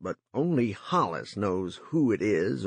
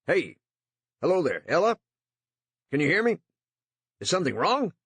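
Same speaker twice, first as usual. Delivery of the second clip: loud, higher, anxious calling; nervous calling out; 9 semitones higher, 4 dB louder, faster than usual. A narrator's reading. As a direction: call out nervously into silence.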